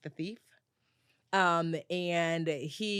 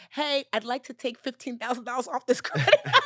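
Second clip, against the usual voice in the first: laughing through it; 7 semitones higher, 5 dB louder than usual. Retold or imitated. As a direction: quote it bright and higher, breaking into a laugh. Retold asking bank for cash.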